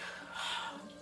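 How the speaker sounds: soft, weary sigh; exasperated sigh. Retold or imitated. Patient fed up with residents, exasperated sigh. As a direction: let out a quiet exasperated breath. Sighs before muttered complaint on residents.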